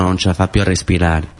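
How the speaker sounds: usual speaking voice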